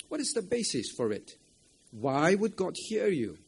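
usual speaking voice

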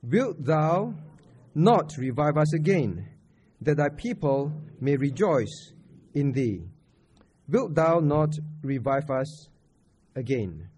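slow, measured verse reading, phrase by phrase; deliberate group recitation with firm emphasis; reading scripture together. Real, not acted. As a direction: lead the congregation through the verse, slowly. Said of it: "Let's read verse six together".

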